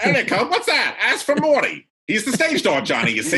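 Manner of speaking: high, loud vintage phone voice; old-time caller. Pretend. Old-time caller answering about area codes, high and loud. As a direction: squawk down an old party line.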